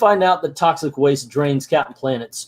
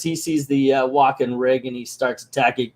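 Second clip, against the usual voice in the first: ordinary delivery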